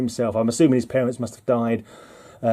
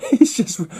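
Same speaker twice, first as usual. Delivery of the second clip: laughing; 9 semitones higher than usual. Real, not acted. Amused by the absurd comic plot.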